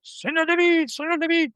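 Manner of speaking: high, ringing call of a name across a busy market; calling out across a crowd. Retold or imitated. Man spotting his friend in a busy Saturday market calls out, high ringing shout. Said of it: "suddenly someone yells".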